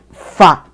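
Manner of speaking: "Fa" said low with the voice dropping; one low falling "fa". Shown as a demonstration. Teacher showing the word for pull, low tone.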